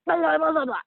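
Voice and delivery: a mocking imitation of a ranting boyfriend; mock ranting gibberish. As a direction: parrot his nonsense rant mockingly. Mocking his sudden ranting.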